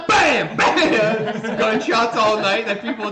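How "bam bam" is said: shouted gunshot noise; mock gunshot. Slasher shooting campers, loud imitated bang. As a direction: bark out a fake gunshot.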